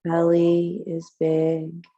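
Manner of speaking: unhurried, calm yoga teacher leading relaxation; guided meditation. Real, not acted. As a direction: guide them gently into the breath, phrase by phrase. Leads a guided breathing meditation.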